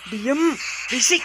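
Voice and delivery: loud, high, drawn-out command for silence; angry outburst. Acted. Husband silencing his wife, angry. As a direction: shout the wife down, slowly and high. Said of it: "dengerin kamu marah-marah".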